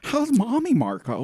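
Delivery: camp it up like the Joker; hammed-up Joker version of the Drode's taunt; Joker-style Drode. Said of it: "Let's redo the Drone as the Joker".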